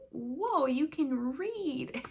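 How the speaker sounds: drawn-out, swooping amazement; amazed. Retold or imitated. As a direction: act out an impressed teacher's wonder. Amazed teacher reacting to a student.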